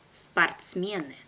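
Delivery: brisk, natural native speed; quick native pace. Shown as a demonstration. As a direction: say it fast like a native. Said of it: "second time will be quickly, like a native".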